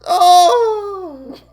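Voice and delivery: loud, high hammed-up wail; acted sobbing. Naz to Ed after her breakup, hammed-up sobbing. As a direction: break into exaggerated crying.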